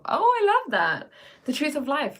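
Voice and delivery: high, bright gush of delight; light up reading it. Real, not acted. Delighted reaction to a chat comment.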